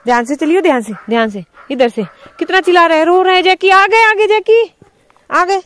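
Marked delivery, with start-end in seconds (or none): 3.7-5.6 loud, high call to the dog. Real, not acted. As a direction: call across the yard to reach the dog. Calling out to the crying dog.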